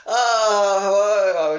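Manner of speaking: high, loud startled greeting; startled hello. Retold or imitated. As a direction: caught off guard by a handshake. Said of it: "So I'm just like".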